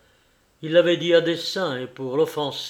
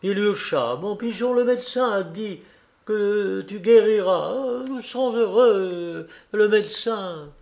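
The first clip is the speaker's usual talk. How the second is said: tearful reassurance; 7 semitones higher, 4 dB louder than usual. A narrator's reading. Father reassuring dying son.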